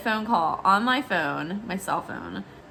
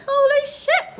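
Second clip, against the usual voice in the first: very high, louder stunned squeal; high-pitched shock; 6 dB louder, slower than usual. Retold or imitated. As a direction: squeal it in disbelief. Same reaction, voiced high.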